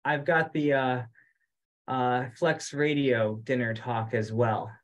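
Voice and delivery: ordinary delivery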